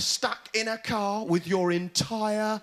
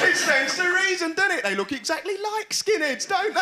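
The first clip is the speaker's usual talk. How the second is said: higher mock enthusiasm; mock ad executive; 11 semitones higher, 3 dB louder than usual. Ad men convincing each other about skinheads, higher mock excitement. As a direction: pitch the idea like a giddy ad man. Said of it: "How are we gonna advertise Weetabix then".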